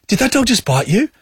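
high-pitched questioning bystander; concerned bystander. Retold or imitated. Lady in her car asks about the bite, high and concerned. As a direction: imitate another woman's high, concerned question.

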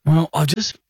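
usual speaking voice

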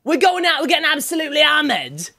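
high-pitched, put-on Geordie accent. Shown as a demonstration. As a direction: do your best Geordie party voice, pitched well up. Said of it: "give us a Geordie accent".

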